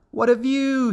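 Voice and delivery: high, thrilled exclamation; delighted frog child. A narrator's reading. Another young frog up in the sky, high delight. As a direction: marvel in a high little frog voice. Young frogs love flying.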